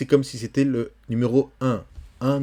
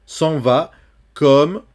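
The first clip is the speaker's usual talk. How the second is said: vers lu plus haut et plus fort; poem reading; 4 semitones higher, 7 dB louder, much slower than usual. Shown as a demonstration. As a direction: lire en détachant, avec force. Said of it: "Attention ici". (lecture de poème appuyée)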